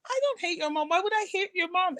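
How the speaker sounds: high, flustered protest; laughing disbelief. Retold or imitated. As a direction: deny it through amused shock. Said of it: "And I was like".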